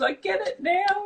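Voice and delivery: high, teary imitation of a crying mum; a hammed-up sob-voice mum on the phone; imitating blubbering. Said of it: "she's, like, blubbering like this".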